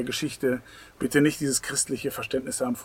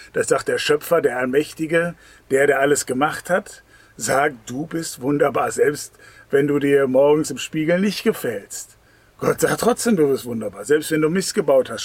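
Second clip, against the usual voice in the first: louder, driving sermon voice; fervent preaching; 6 dB louder than usual. Real, not acted. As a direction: preach it out to the congregation. Preaching at full force.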